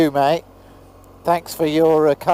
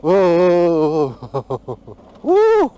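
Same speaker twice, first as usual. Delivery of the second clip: loud whoa then woo; 3 semitones higher, 4 dB louder than usual. Real, not acted. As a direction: whoop as the bike slides. Bumpy descent on bike.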